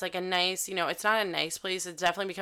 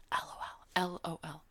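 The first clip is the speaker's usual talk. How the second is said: breathy whisper; whispered aside; 9 dB quieter than usual. Real, not acted. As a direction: whisper it like a private joke. Aside after inner quote.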